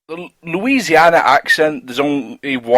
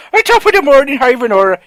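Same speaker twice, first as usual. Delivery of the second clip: high, loud stage-Irish greeting; mock Irish accent; 11 semitones higher, 5 dB louder than usual. Teasing an Irish friend about Rocket League, mock Irish accent. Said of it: "it's proper sticks out like".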